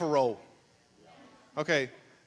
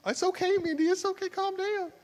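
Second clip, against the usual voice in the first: high, softer teasing reassurance; calm her down playfully, pitched up; mock soothing; 14 semitones higher, 4 dB quieter than usual. Real, not acted. Pastor calming an over-excited Mindy, high soothing tease.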